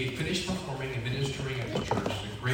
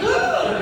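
shocked gasp; 10 dB louder than usual. Retold or imitated. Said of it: "She was like".

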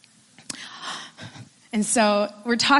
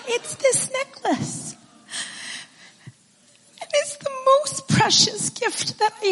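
tearful; 12 semitones higher than usual. Real, not acted. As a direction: speak through lingering tears. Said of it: "still tearful after the gift".

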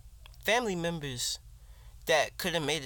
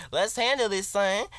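higher, louder taunt; louder challenge; 5 semitones higher, 5 dB louder than usual. Real, not acted. Rapper challenging anyone with a problem, louder taunt. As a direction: push the challenge at them, son.

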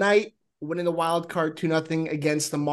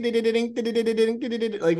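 imitated dings; 5 semitones higher, faster than usual.

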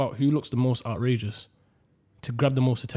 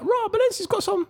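high-pitched hyped reaction; excited. Retold or imitated. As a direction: squeal it out, impressed. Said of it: "I was like".

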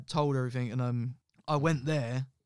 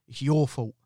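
louder, heavy blaming voice; accusing ghost voice; 5 dB louder than usual. Retold or imitated. Uncle in the dream blaming him, a loud accusing voice. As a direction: lean on it like a haunting accusation.

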